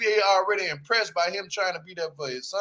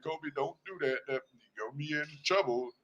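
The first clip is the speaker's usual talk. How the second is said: lower, much softer, timid father voice; mock scared father; 6 semitones lower, 11 dB quieter than usual. Father begging his son, timid and hushed. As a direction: whimper it like a nervous pushover dad.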